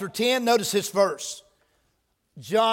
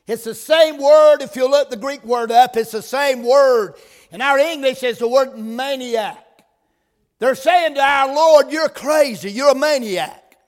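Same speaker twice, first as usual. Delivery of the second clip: louder, slower preaching on "same word"; preaching at full force; 3 semitones higher, 4 dB louder than usual. Acted. Preacher pressing the meaning to the church, fervent. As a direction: press it home like a revival preacher.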